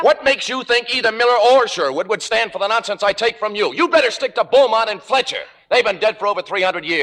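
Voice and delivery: angry retort. Acted. Playwright quarrels with Margo.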